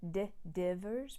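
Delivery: divers with a plain D to show the tap; D sound. Shown as a demonstration. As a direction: say divers with a crisp D. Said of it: "a D sound".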